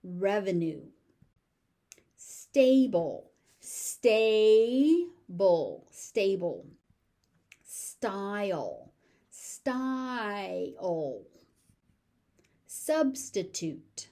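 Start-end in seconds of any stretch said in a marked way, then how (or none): 3.6-5.9 "Stable" stretched loud as stay, bul; slow, loud model of "Stable"; syllable by syllable. Shown as a demonstration. Teacher breaks word into syllables. 9.3-11.2 "Style" stretched into sty, ul; drawn out. Shown as a demonstration. Teacher stretches word for learners.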